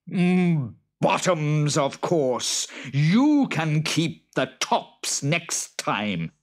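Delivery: high, loud hammed-up Boggart. Boggart snapping at the farmer, high and loud. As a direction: squeal it out like a cross little monster.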